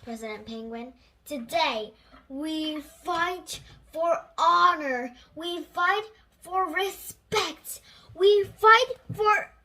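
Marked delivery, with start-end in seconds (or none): 1.3-9.5 loud, slow battle speech building in force; rally the troops with rising fury; rousing speech. Acted. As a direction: penguin president rouses his crew for battle.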